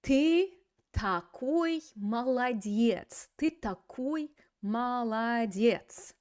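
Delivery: lightly voiced praise to a little kid, slow; praising a child. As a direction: praise a small child warmly. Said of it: "talking to a little kid".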